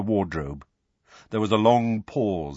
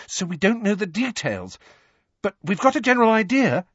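fast, higher, rushing the words; hasty addition; 12 semitones higher, 4 dB louder than usual. A narrator's reading. Hermione hastily reassuring Harry before he explodes, rushed. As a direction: hurry to calm him down. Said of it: "she added hastily".

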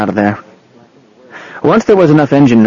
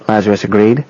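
ordinary delivery, nothing marked

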